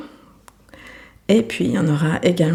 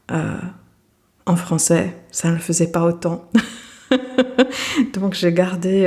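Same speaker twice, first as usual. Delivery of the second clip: ordinary talk, nothing marked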